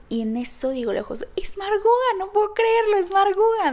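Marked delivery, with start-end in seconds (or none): 1.3-3.7 high, loud squeal of disbelief; thrilled recognition. Retold or imitated. Spotting her mentor across the street, squealing with excitement. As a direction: shriek it like a starstruck fan.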